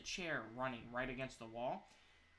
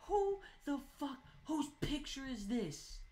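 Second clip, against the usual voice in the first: very high, slow, louder disbelief; incredulous outburst; 12 semitones higher, 5 dB louder, slower than usual. Real, not acted. Gamer staring at a broken picture, high incredulous cry. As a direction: stretch each word in stunned disbelief.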